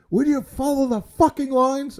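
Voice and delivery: high, booming, drawn-out yell. Retold or imitated. Shopper bellowing at people ignoring floor lines, yelling. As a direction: let the big voice boom across the store. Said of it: "yelling at the top of my lungs".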